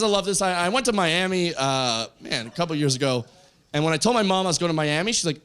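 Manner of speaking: normal talking voice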